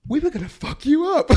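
loud, high-pitched, laughing retold threat; laughing quote. Protester admitting his plan to the reporter, retold laughing. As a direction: crack up while quoting the threat.

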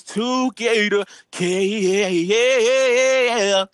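hype intro. Acted. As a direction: build the hype on each yeah. Hyped intro of co-host.

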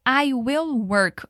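"I will work" stretched out very slowly, word by word; slow model phrase. Shown as a demonstration. Teacher gives students slow "I will work" to repeat. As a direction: drag out "I will work" for learners to copy. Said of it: "Agora diga".

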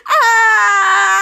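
long, high, loud "ah"; one sustained play scream; mock group scream. All retold characters scream.

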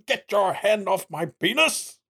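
playful, theatrical protest; Charles Doja impression. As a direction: imitate an outraged orator without shouting. Mimicking the shout in played clip.

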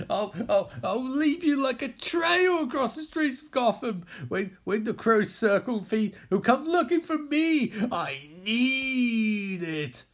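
high, stammering desperate plea; crying villain. Acted. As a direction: beg tearfully, rising to a wail. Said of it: "as Scarecrow starts crying".

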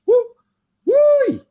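high, loud mock "woo" yell; wrestling woo. Podcaster cheering a woman wrestler, loud high woo yell. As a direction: let out a big arena-style "woo".

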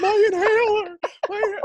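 high, wailing mock of a winded fighter; mocking whiny voice. Cohost mimics the fighter begging for his inhaler, high and whiny. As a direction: whimper like a kid who lost his inhaler.

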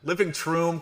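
"troom" with a TR, high and incredulous; mocking troom pronunciation. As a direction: throw "troom" back in disbelief. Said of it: "I say troom".

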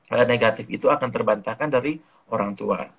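ordinary talk, nothing marked

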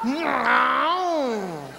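long high cry, sliding down; hammed-up tearful howl of refusal; mock wail. Woman fighting back tears at midday, comic drawn-out wail.

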